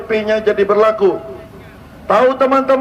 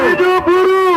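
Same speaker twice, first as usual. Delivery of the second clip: fiery revolutionary speech; 6 semitones higher than usual. Real, not acted. Rally leader leads a chant.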